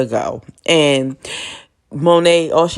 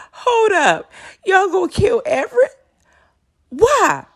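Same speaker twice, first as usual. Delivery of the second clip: put-on much higher, boyish confused voice; confused young Zeke. Zeke shocked at the family's kill plan, high and confused. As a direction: play the baffled kid who just caught on.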